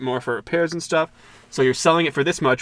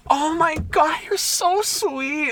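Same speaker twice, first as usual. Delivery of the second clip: put-on very high, drawn-out girly coo; mock gushing girl; much slower than usual. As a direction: imitate girls melting at a sob story. Imitating girls gushing at the story.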